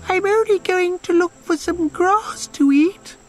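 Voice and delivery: squeak. Acted. Little goat answering the troll under the bridge, high squeak. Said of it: "managed to squeak".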